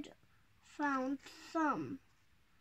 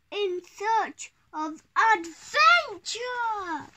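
excited; 5 semitones higher, 11 dB louder than usual. Acted. Child reading adventure aloud.

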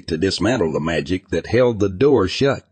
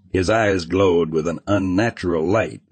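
usual speaking voice

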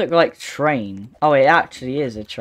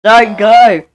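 loud, higher-pitched urgent shout; shouted warning; 6 semitones higher, 10 dB louder than usual. Real, not acted. Player warning others off the deadly electric, urgent shout. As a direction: call out sharply to stop them.

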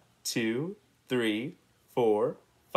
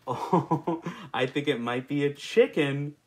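ordinary delivery

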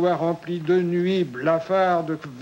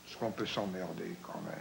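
a much softer, hollow, quick murmur; 8 semitones lower, 14 dB quieter, faster than usual. Acted. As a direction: murmur it low from the bottom of a trench. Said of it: "d'une voix caverneuse".

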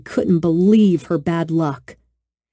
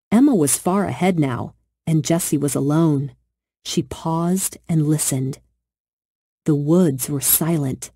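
usual speaking voice

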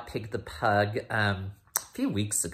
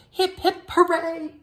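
much higher, bright cheering call; 6 dB louder than usual. Acted. Teacher cheering Pig stopped lying, high excited cheer. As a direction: cheer it out for the kids.

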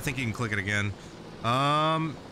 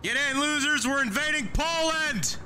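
excited shout; 12 semitones higher than usual. Acted. Hyped war cry to squad.

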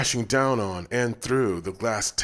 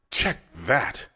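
startled man; 4 semitones higher, 4 dB louder than usual. A narrator's reading. Joe reacts to destruction.

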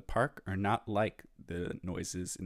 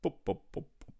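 playful higher boops like a dribbled ball; bouncing ball sounds; 6 semitones higher, faster than usual. Retold or imitated. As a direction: bounce each boop out. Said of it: "dribbling his basketball".

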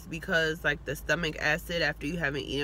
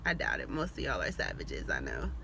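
dry deadpan tease; 13 semitones lower, 4 dB quieter than usual. Pretend. Dry teasing aside.

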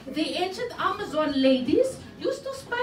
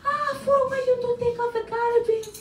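nagging wife; 7 semitones higher than usual. Retold or imitated. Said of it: "You tell your husband".

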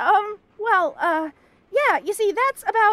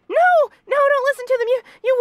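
high, loud panicked protest; panicked shout; 6 semitones higher, 4 dB louder than usual. Acted. Bunny girl yelling at her roommate not to listen, panicked. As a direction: stop him before he hears. Roommate about to play videos.

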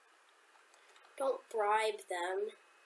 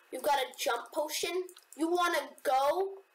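excited taunt; 7 semitones higher than usual. Real, not acted. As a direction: taunt them eagerly. Taunting an opponent.

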